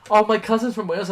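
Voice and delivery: mock enthusiastic caller; chatty caller. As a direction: overeager small talk. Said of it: "they're like".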